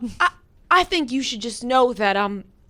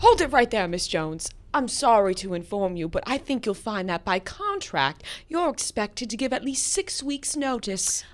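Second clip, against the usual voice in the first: put-on British accent; 5 semitones lower, 5 dB quieter than usual. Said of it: "You're doing a good accent".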